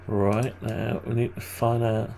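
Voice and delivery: ordinary delivery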